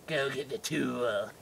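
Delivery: slow, quiet villain dentist; mock mad-doctor voice; sinister doctor. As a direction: order it slowly like a creepy villain. Sinister doctor orders the nurse.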